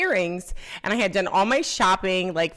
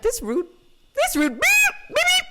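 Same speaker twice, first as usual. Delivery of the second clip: self-bleeped insult. Retold or imitated. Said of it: "I'm like".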